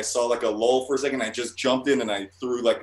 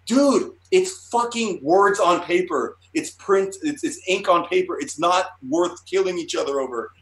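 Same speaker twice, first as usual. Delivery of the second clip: raised, high-pitched imitation of pleading; exasperated pleading; 8 semitones higher, 4 dB louder than usual. Panelist breaking up a fight over comics, pleading. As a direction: talk down two brawlers, exasperated.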